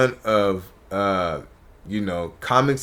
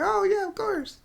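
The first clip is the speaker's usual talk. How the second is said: airy, high-pitched woman's voice, softer; high quoted reply; 7 dB quieter than usual. Retold or imitated. Woman agreeing to a quick request, high soft voice. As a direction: lift it high and light for her answer.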